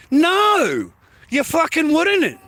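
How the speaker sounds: loud, high, drawn-out refusal; real high-pitched indignation, slow and punched; indignant outburst. Real, not acted. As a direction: fire back an emphatic, exasperated denial. Answers own rhetorical question indignantly.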